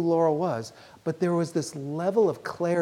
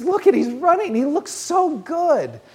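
higher-pitched, wonderstruck excitement, drawing out the praise; excited marvelling; 9 semitones higher, 4 dB louder than usual. Retold or imitated. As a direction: imitate an excited patient seeing a vision. Patient excitedly points out cousin he sees.

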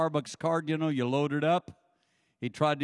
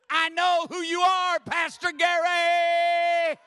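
much higher, loud and drawn-out call; mock shout; 12 semitones higher, 7 dB louder, slower than usual. The barista greeting Gary, hammed-up shout. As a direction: belt it out in delight. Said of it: "she says".

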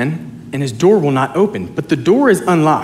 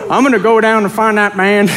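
higher, hammed-up New Orleans accent; 6 semitones higher, 3 dB louder than usual. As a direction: power through a bad New Orleans impression. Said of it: "in [name]' accent".

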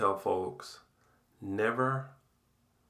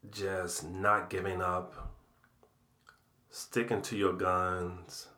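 normal talking voice

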